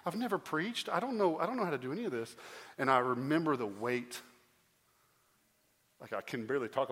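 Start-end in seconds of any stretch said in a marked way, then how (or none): 0.0-2.3 panicked disbelief. Retold or imitated. Pastor reenacting his panic at being named senior pastor. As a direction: voice climbing much higher into a frantic cry. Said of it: "And I'm like".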